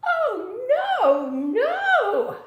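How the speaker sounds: tiny fly squeaking a high, laughing no; a little fly laughing off the offer in a high squeak. A narrator's reading. Fly refusing the spider, high-pitched and laughing. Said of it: "said the little fly".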